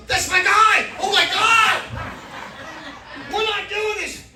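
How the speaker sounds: high, loud yell of dismay; panicked outburst. Retold or imitated. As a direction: cry out in comic horror. Acted alarm at seeing his surgeon.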